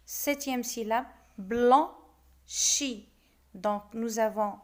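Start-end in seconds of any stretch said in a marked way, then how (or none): 1.4-3.1 "Blanchi" split into two loud beats; long pause between "Blan" and "chi"; syllable by syllable. Shown as a demonstration. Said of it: "on va découper les vers".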